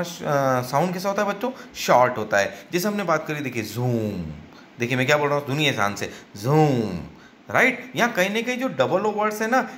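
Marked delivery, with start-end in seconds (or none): 3.6-4.3 जूम with a long, held "oo"; long vowel. Shown as a demonstration. Teacher demonstrating a long double O to children, जूम drawn out. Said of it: "इसका long sound है". 6.4-7.0 जूम with a long, held "oo"; long vowel. Shown as a demonstration. Teacher demonstrating a long double O to children, जूम drawn out. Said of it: "इसका long sound है".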